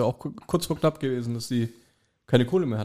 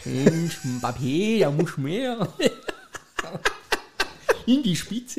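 halftime pep speech; 4 semitones higher, much slower than usual. Pretend. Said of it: "wer von euch macht jetzt Löw nach".

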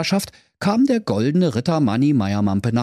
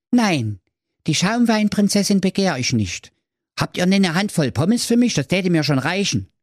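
high modest knight; high Manni voice; 9 semitones higher than usual. Acted. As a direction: ask shyly in a high voice.

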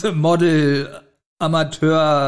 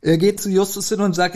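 ordinary delivery

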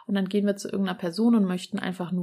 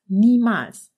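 loud refusal; 3 semitones higher, 11 dB louder than usual. A narrator's reading. Fish refuses in horror.

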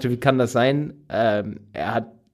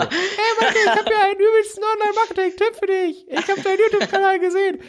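put-on very high, squeaky kid's voice, laughing through it; mock the young caller's squeaky voice; faster than usual. Host imitates the teen's squeaky phone call, very high and laughing. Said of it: "so eine piepsige Stimme".

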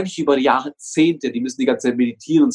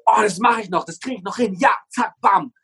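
higher, eager pep talk to oneself; self-motivating push; 6 semitones higher than usual. Acted. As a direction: psych yourself up for the last bit. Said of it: "sagst du dir". (aufgekratztes Selbstanfeuern)

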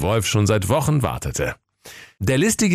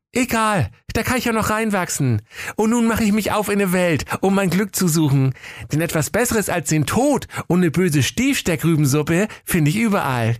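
put-on high, bright young hero voice; high boy voice; 10 semitones higher than usual. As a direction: play a cheeky poor boy. Pelle speaks, held voice.

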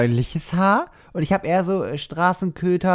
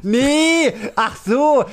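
excited; 9 semitones higher, much slower than usual. Real, not acted. Guessing game, sudden realisation.

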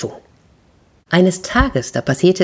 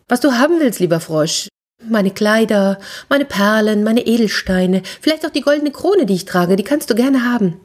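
higher, eager princess offering; 6 semitones higher than usual. Acted. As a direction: list the treasures eagerly, like a princess. Princess offers her treasures.

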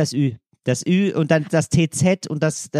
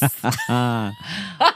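laughter; 2 semitones lower than usual. Real, not acted. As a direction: burst of laughing. Laughing at Furz game.

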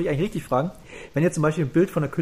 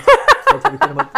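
squeaky high; 13 semitones higher, 11 dB louder than usual. Real, not acted. Squeaks, then repeats line plainly.

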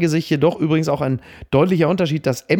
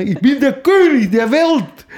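higher, louder, slow triumphant cry; roaring movie hero; 9 semitones higher, 4 dB louder, slower than usual. Retold or imitated. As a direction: bellow it like a hammy film star. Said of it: "Sowas halt".

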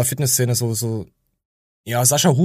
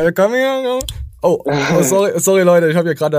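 loud, flustered shock; startled; 4 semitones higher, 5 dB louder than usual. Real, not acted. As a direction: blurt it out caught off guard. Startled outburst at the video.

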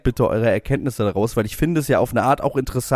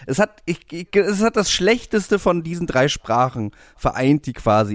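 usual speaking voice

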